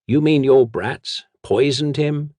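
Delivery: put-on an old nobleman outraged; mild indignant outburst.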